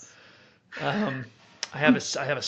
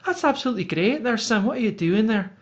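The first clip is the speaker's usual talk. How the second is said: Roanna; 8 semitones higher than usual. Shown as a demonstration. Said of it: "I change the pitch and I do an accent".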